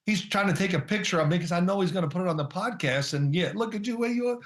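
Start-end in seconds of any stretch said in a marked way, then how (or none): 3.3-4.4 higher teasing voice imitating his son; lightly voiced teasing son; mocking son. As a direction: play the son poking fun at dad. Father imitates son teasing him.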